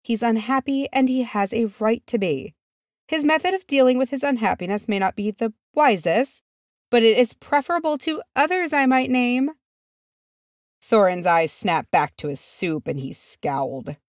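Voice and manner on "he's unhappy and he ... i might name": higher-pitched reproving voice; stern matriarch lecturing the table. A narrator's reading. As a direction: admonish them in a raised, measured pitch. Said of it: "giving their table a stern look".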